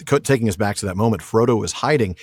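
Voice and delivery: ordinary talk, nothing marked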